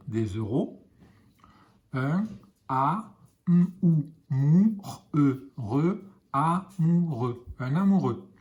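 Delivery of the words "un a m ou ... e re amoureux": put-on patient blending for young readers; sounding out slowly. Shown as a demonstration. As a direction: build amoureux sound by sound, stretching it slowly. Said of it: "teacher sounds out letters".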